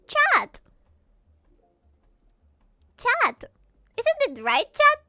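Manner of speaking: high, loud calls of Chat; streamer calling chat. Retold or imitated. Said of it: "People will be like".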